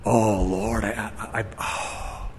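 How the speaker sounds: low, soft, crumbling lament; despairing groan. Retold or imitated. Convicted sinner crying out to the Lord, low and broken. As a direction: fade into a hopeless sigh. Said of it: "should make you go".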